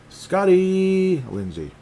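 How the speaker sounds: playful stretched name read; drawn-out name. Pretend.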